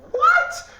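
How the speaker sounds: a very high, loud yelp with a laugh; laughing disbelief. Retold or imitated. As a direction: squeal it, amazed and laughing. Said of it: "I was like".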